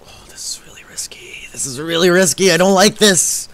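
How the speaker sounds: tense whisper while sneaking; nervous whisper. Real, not acted. Sneaking up during a risky play, nervous whisper. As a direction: whisper it, creeping past danger.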